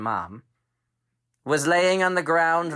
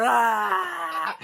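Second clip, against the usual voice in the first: panicked scream; 9 semitones higher than usual. Acted. Blinded raptor running.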